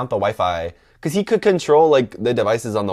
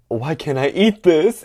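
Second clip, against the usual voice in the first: higher, louder, drawn-out acted panic; mock alarm, raising the pitch; rising panic; 7 semitones higher, 5 dB louder, slower than usual. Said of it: "I started panicking even more".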